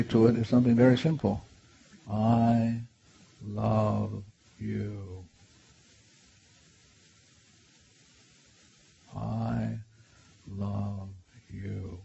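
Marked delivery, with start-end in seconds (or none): 2.1-12.0 soft, slow and tender, word by word; hushed, lingering declaration of love. Shown as a demonstration. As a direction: let each word settle gently. Tender words to inner child.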